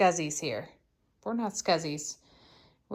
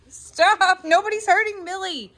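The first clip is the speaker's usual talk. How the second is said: loud scolding; 10 semitones higher, 9 dB louder than usual. Real, not acted. Scolding dogs amid chaos.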